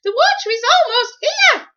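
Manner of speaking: very high, loud squeaky helper voice; squeaky giraffe voice. Acted. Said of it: "he squeaked in his high voice".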